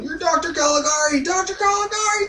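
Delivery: very high mock-hysterical cry; freaking out. Francis spotting the doctor, mock freak-out in a very high voice. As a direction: squeal the accusation way up high, hammed-up. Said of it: "Francis is freaking out, going".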